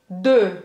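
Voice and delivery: De said with lips rounded for O while saying E; De with an O-shaped mouth. Shown as a demonstration. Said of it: "Faz a boca do O e fala E".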